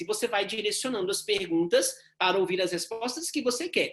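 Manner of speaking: ordinary talk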